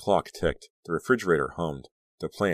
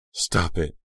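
sharp insistence. A narrator's reading. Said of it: "insisted sharply".

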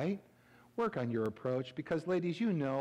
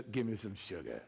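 low, soft, smooth-talking swagger; 8 semitones lower, 7 dB quieter than usual. Shown as a demonstration. Pastor showing congregation how to say it, swaggering. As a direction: drop it low and pour on attitude. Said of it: "say it with attitude".

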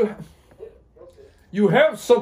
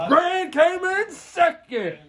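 high, slow singsong teasing; teasing announcement; 12 semitones higher, much slower than usual. Real, not acted. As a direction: announce it to rub it in. Teasing announcement of Gran's place.

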